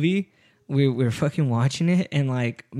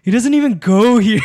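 loud, higher-pitched mock outburst; quoted outburst; 7 semitones higher, 8 dB louder than usual. Woman quoting a film at him, loud outburst. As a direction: call it out like a movie quote. Acting out her movie-quote comeback.

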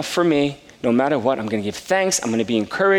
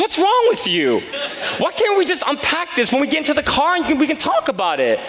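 wife upset; 10 semitones higher than usual. Retold or imitated. Wife scolding him in the parking lot, exasperated and high. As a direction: play the embarrassed wife scolding. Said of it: "She started getting very upset at me".

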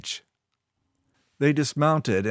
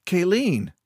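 higher-pitched protest, falling; calling her name; 8 semitones higher than usual. A narrator's reading. Keo pulling back from Kayleen's kiss, protesting.

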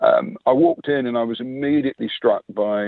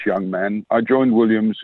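ordinary talk

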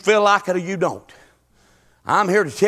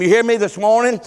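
normal talking voice

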